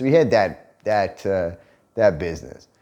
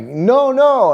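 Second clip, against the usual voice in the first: nervous denial; 10 semitones higher, 5 dB louder than usual. Retold or imitated. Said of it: "the guy's like".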